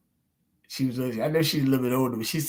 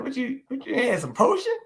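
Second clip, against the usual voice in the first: higher, teasing mock question about a potion; 9 semitones higher than usual. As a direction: rise up high as if mocking a witch. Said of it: "You acting like a damn witch".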